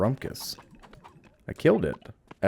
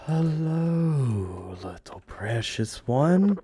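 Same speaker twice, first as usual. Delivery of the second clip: high, slow cooing at tiny creatures; 8 semitones higher, much slower than usual. Real, not acted. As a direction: coo like greeting a newborn pet. Cooing at cute baby creatures.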